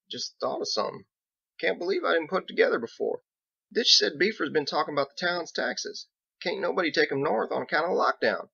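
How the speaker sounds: a rough country lawman thinking aloud; Biggs. Acted.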